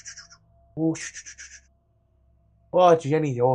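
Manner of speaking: guard fussing soothingly over spooked pigeons; calming the birds. Acted. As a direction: shush and coo reassurance in little repeated bursts. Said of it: "trying to, like, calm the birds".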